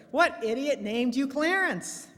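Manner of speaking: scornfully mock the parrot; sneering. Burglar sneering at the parrot, high, loud and slow. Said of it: "sneers the burglar".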